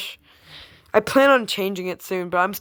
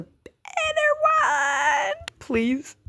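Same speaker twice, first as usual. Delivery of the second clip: much higher, slow, crying-out plea; high pleading shout; much slower than usual. Acted. A YouTuber begs viewers to watch the remaster, high crying shout. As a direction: wail it like a desperate plea.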